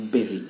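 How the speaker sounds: "bury" rhyming with very; berry pronunciation. Shown as a demonstration. Teacher giving the correct form, berry pronunciation. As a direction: say "bury" as BER-ee.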